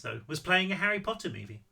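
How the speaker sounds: usual speaking voice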